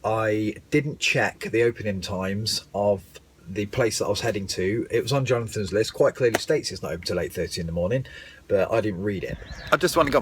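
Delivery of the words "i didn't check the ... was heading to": usual speaking voice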